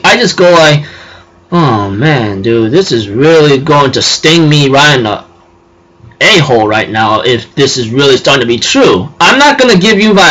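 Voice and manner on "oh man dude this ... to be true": dismayed groaning. Retold or imitated. Viewer dreading where the episode goes, low dismay. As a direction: sink low in mock dismay.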